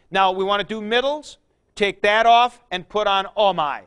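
ordinary talk, nothing marked

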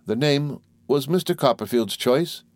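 a touch of meek self-defence; meek young mother. A narrator's reading. Voices the mother.

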